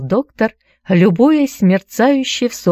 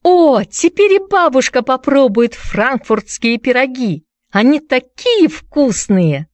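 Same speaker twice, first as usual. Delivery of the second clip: joyful cry; 8 semitones higher than usual. A narrator's reading. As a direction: cry out with pure joy. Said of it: "она закричала от радости".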